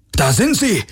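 high-pitched guard's cry; a guard spots the fugitives, calling out high. Acted. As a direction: spot them and alert the others. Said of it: "rief einer von ihnen".

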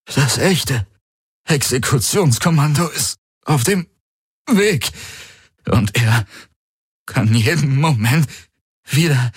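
urgent and hurting, pushing every word; strained, hurting Galen. A narrator's reading. Galen warning Kirai and Hawks the squad is coming, strained and urgent.

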